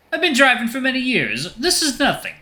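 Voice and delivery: high, cartoonish Italian accent; boastful Italian cab driver. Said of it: "stereotypical Italian voice".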